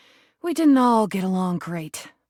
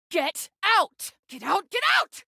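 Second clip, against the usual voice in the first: repeated high-pitched furious orders, the last one highest; angry get out; 12 semitones higher, slower than usual. Acted. Rubber duck throwing the player out, pitched-up angry shouting. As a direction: throw them out, climbing in pitch each time.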